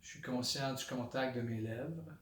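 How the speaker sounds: soft, low meditation voice with long pauses; hushed, unhurried body-scan guidance; guided meditation. Shown as a demonstration. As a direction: settle into quiet, centred awareness, naming each sensation softly. Leads a body-scan meditation aloud.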